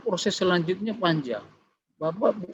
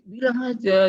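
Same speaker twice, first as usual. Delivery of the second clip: higher, mildly annoyed retort; retold anger; 7 semitones higher than usual. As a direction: imitate your own annoyed insistence. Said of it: "Saya marah saya kan". (menirukan nada marah ringan)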